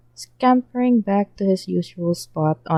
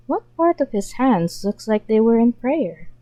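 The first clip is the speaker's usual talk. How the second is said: usual speaking voice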